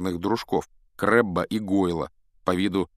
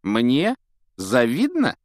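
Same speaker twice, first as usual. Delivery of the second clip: two high, loud incredulous questions; scornful disbelief; 5 semitones higher, 5 dB louder, slower than usual. A narrator's reading. Malfoy answers Colin's charge of envy, incredulous. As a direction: scoff at the very idea.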